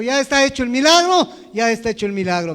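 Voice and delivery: booming, emphatic announcement of a done deed; an old man loudly proclaiming the miracle is done; loud proclamation. Retold or imitated. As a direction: declare it loudly like a stern old preacher.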